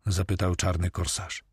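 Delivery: plain reading voice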